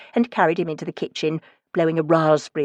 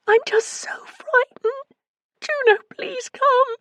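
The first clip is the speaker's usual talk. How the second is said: high, slow, pleading and tearful; frightened friend begs Juno to come; almost hysterical; slower than usual. A narrator's reading. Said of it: "She sounded almost hysterical".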